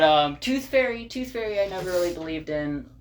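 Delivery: ordinary delivery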